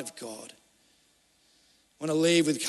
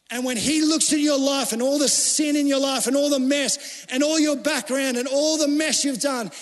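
loud, pressing sermon delivery, pitch raised; fervent preaching; 6 semitones higher, 3 dB louder than usual. Acted. Preacher pleading with the congregation, loud and fervent. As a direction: drive it home from the pulpit.

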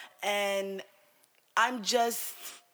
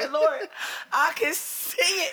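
high and loud, laughing through the words; slow, giggling tease; 6 semitones higher, 5 dB louder than usual. Retold or imitated. Laughing as she teases Aunt Lauren about the dream.

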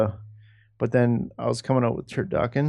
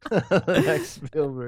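laughing; 6 semitones higher, much slower than usual. Real, not acted. Laughing repeat of friend's line.

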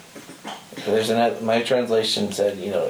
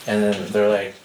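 ordinary delivery

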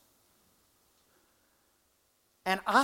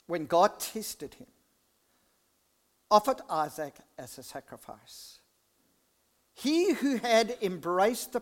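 scripture reading; 3 semitones lower than usual. Acted. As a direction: read the verses solemnly to the congregation. Reads scripture aloud in church.

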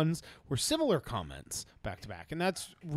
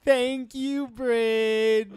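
higher, louder cheerful thanks; excited thanks; 8 semitones higher, 3 dB louder, much slower than usual. Real, not acted. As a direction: thank her with a big lift.

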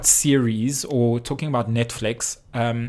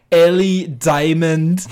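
put-on loud, high, drawn-out Scottish accent; 5 semitones higher, 8 dB louder, slower than usual. [name] teaching [name] another Scottish name, loud exaggerated Scottish accent. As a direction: belt it out in a slow mock Scottish accent. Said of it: "with a Scottish accent".

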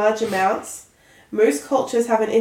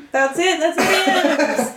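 relieved laughter; 8 semitones higher than usual. Real, not acted. Finishing the long idiom list.

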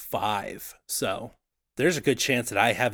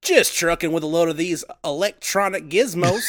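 trucker on CB radio; 8 semitones higher than usual. Acted. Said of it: "I will be Rubber Duck".

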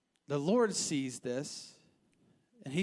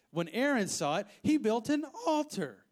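rising to a much higher, louder indignant cry; real anger; 6 semitones higher, 4 dB louder than usual. Real, not acted. Preacher indignant at Aaron for the idol, angry high cry. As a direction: let the anger burst out on altar. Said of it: "sorry, I'm mad".

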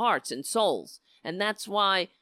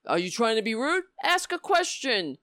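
raised-pitch retold rebuke; mock indignant demand; 6 semitones higher, 4 dB louder than usual. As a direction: imitate an indignant scolding. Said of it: "he says".

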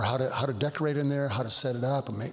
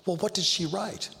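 higher, lightly voiced sister asking; mock curious sister; 7 semitones higher than usual. Sister asking the pastor what the bride wrote, high and curious. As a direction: play the puzzled sister, pitched up. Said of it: "sister asks pastor".

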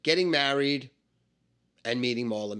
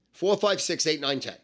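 "four" to "ten" run together in one quick string; rattled off; 2 semitones higher than usual. Shown as a demonstration. Recapping the dice numbers for a beginner, "four" through "ten" rattled off. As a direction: rattle off "four, five, six, eight, nine, ten" without pauses.

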